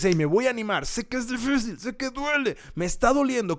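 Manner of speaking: very high, straining voice; acted effortful self-rallying; strained determination. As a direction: push through the pain as you speak. Voices someone rallying through pain.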